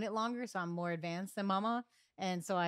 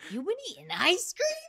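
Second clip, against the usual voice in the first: mock disbelief; 4 semitones higher, 4 dB louder than usual. Acted mock disbelief at partner.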